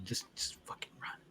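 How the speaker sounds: hushed, breathless warning whisper; captured soldier whispering a warning to Baki; urgent whisper. Acted. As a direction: whisper it like a secret threat.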